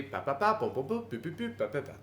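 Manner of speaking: even, repeated babble syllables mimicking French; imitated French speech rhythm. Showing how uniform speech in France is, evenly chopped babble. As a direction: tap out uniform French cadence in nonsense syllables. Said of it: "That's how they all talk".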